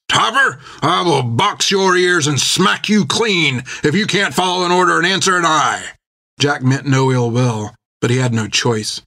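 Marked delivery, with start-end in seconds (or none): 0.1-5.9 angry captain shouting, much higher; roaring threat. A narrator's reading. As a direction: roar it right into his face. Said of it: "roared into his face".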